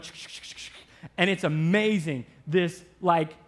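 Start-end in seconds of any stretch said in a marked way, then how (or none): 0.0-0.7 quick, quiet repeated shushes; soft unvoiced "shh" in a steady string; shushing noise. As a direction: imitate a rhythmic shushing sound. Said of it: "I start going".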